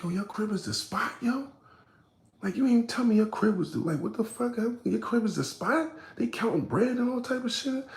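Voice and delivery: high, far put-on indignant voice; mocking high outrage; mock outraged high voice. Angry retold quote, high put-on.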